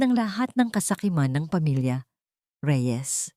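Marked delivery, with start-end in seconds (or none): none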